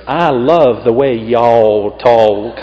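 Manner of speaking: slow, drawn-out Southern drawl, y'all stretched long; Mississippi drawl. Retold or imitated. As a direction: imitate a lovely Southern lady, very slow. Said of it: "she said after the service".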